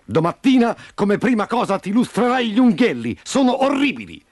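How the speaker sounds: high, screechy reprimand; shrieked scolding. A narrator's reading. Rooster chief inspecting a hen's claws, shrieking. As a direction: screech at her like a drill sergeant. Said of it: "Poi strillò a Prunella".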